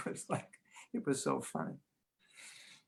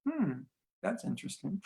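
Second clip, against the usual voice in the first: soft, higher and unbothered; detached calm; 6 semitones higher, 9 dB quieter than usual. Retold or imitated. Walking past noisy devotees, detached and soft. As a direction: brush it off serenely. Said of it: "you chant your mantra".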